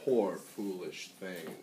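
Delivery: ordinary delivery, nothing marked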